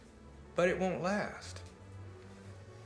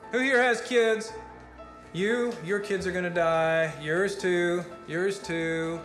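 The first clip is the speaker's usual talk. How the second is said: raised, higher, emphatic prophecy; doomsday rant; 4 semitones higher, 3 dB louder than usual. Acted. Speaker tells the crowd everyone will die, ranting loudly. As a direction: hammer each disaster home to the crowd.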